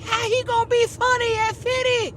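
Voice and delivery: very high, squeaky naysayer voice; high-pitched mock disbelief; mocked doubters. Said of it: "people say".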